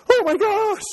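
high, laughing mock panic; panicked Elijah. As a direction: squeal it out like a startled prophet. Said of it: "He's like".

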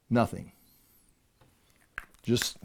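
low, very soft, after a pause; hushed anticlimax. Shown as a demonstration. Preacher shows the congregation heaven's silence, hushed. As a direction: let it drop away into silence.

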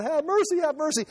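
half-cry have mercy up high, not full volume; imagined cry for mercy. Pretend. Preacher imagines Jesus on the cross crying out, high mock plea. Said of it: "he should be screaming out".

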